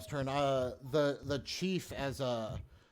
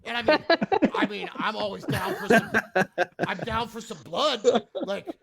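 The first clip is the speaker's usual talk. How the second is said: high, loud, incredulous hobgoblin ranting; indignant rant; 9 semitones higher, 8 dB louder than usual. Acted. Hobgoblin to players who exploded Bob, indignant rant. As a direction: rail at the intruders in raised, animated voice. Hobgoblin rants about Bob's killing.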